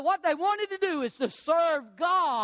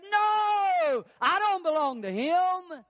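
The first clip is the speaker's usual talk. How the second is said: high, drawn-out protesting "no"; emphatic denial; 6 semitones higher, slower than usual. Acted. Peter denying Jesus to his accuser, emphatic denial. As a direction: deny it hard, pitch jumping up.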